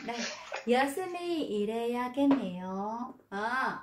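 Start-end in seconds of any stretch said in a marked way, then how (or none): 0.7-3.0 Yoshimi ireyagenneyo read very slowly, syllable by syllable; teacher spells out Yoshimi ireyagenneyo slowly; drawn-out demonstration reading; slow model reading. Shown as a demonstration. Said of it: "cho nên cô không cố tình".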